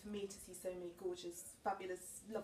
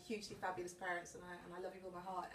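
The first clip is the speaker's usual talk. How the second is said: ordinary delivery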